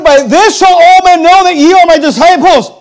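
preacher booming the true words of Jesus; emphatic preaching. Shown as a demonstration. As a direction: proclaim the verse with full force. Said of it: "But he said".

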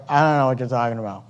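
low, soft and quick, a sheepish mumble; baffled Job. Acted. Job caught out by God's question, in a low soft mumble. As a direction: shrink away and rush out the excuse. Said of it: "And Job's like".

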